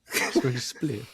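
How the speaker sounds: slow, put-on British echo; mock British accent. Host repeating co-host's British slang, slow mock British accent. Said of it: "lost all of our British listeners".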